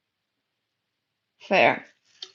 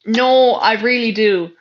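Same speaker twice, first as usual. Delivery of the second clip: ordinary talk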